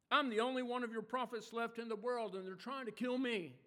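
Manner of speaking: loud, exasperated outburst, building; angry complaint. Acted. Elijah answering God from the cave, furious. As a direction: vent every grievance at God. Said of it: "the anger in his voice".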